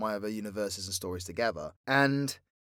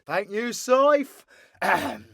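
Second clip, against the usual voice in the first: higher, drawn-out villain thanks; theatrical villain character; 9 semitones higher, 6 dB louder, slower than usual. Acted. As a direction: take the stage grandly, then clear the throat. V takes over from the creator.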